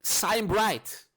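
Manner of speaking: a hint of a high heroine voice; lightly voiced champion quote. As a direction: say it like a game hero's catchphrase. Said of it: "what she says is".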